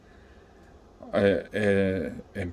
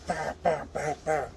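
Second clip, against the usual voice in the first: higher, soft "bah" gunfire noises; imitated gunshots; 6 semitones higher, 10 dB quieter than usual. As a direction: mimic shots softly, pitched up.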